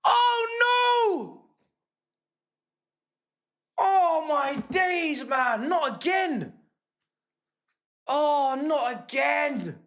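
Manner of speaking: high, drawn-out cry of despair; dismayed wail. Real, not acted. Fan sees the goal in danger again, dismayed wail. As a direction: wail it slowly, real disbelief.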